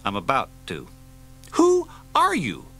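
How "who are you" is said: high, loud and slow challenge; shouted demand. Acted. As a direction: bark the question at the stranger. Janus confronts Kay, shouting.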